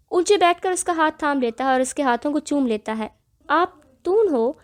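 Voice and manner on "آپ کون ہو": put-on high, childish lisping wonder; a small girl puzzled by a stranger; surprised little girl. A narrator's reading. Child asking her new father who he is. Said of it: "پری حیرانگی سے پوچھتی ہے".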